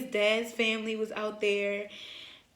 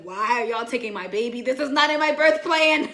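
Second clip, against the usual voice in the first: mock outrage, rising into a laugh; retold indignant protest; 2 semitones higher, 10 dB louder than usual. Mother protesting her baby being taken, retold loud indignation.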